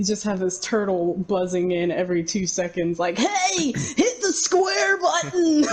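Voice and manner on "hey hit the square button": high, slow laughing nerd shout; nerdy surfer guy yelling. Retold or imitated. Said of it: "Now you just sound like a surfer dude".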